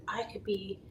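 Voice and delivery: breathy whisper; a cheeky whispered joke; whispered aside. Retold or imitated. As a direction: let it slip out as a secret. Joking aside about weight.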